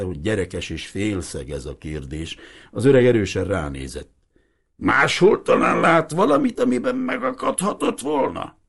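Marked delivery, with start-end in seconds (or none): 4.8-8.6 a lightly voiced old man, insistent; old fisherman. Old Hiribi demanding the priest explain the boat, lightly voiced.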